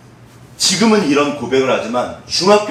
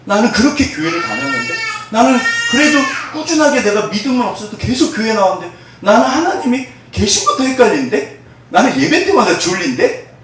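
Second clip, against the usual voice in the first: higher, raised protesting voice; mock complaint; 6 semitones higher than usual. Frustrated churchgoer complaining to God, raised and higher. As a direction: whine at God about being overlooked.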